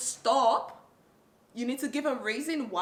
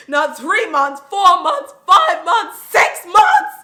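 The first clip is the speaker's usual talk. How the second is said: building to shout; 6 semitones higher, 15 dB louder, slower than usual. Real, not acted. Building list of sleepless months.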